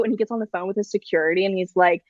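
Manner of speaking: ordinary talk, nothing marked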